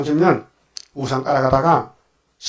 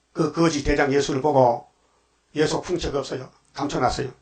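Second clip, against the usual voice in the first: ordinary talk, nothing marked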